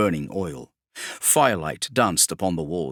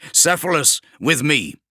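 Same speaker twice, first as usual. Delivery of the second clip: higher and louder barked battlefield orders; commanding warsmith; 6 semitones higher, 4 dB louder than usual. Acted. Kravarek orders his men to cover the demigod, loud and commanding. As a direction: bark it across the hold to your squads.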